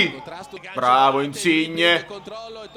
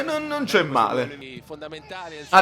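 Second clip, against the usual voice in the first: normal talking voice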